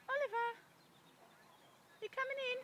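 loud high call with a questioning lift. Real, not acted. Owner calling her horse to come in, loud call. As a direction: call out and ask him in.

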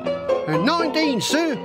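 brief hesitation, then high and keen; eager boy recruit. Acted. Boy lying to the sergeant, hesitant then high. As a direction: fib smartly in a high young voice.

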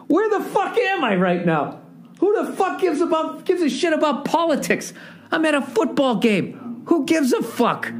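incredulous rant. Real, not acted. Comic ranting to the audience in high-pitched disbelief. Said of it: "incredulous rant at the game".

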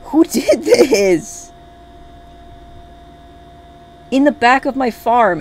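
loud, high, outraged demand; really mad. Real, not acted. Player demands who killed the chicken, angry yelling. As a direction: yell for the culprit, dragging the first words out. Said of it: "actually mad".

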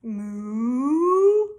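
slow, drawn-out cow moo; puppy moos. Acted. Puppy George tries again, a long moo. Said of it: "George went moo".

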